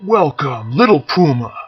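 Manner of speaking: booming, jovial warrior greeting; loud, friendly bellow of welcome; hearty warrior. Acted. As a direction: greet the boy like a big cheerful bear.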